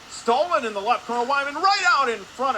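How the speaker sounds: loud, high burst on a turnover; excited play-by-play. Real, not acted. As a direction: shoot the voice up as danger appears at the net. Calling sudden steal near goal.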